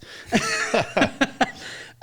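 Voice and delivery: unvoiced chuckle; breathy laugh. Real, not acted. Laughing to the interviewer about being called Hollywood.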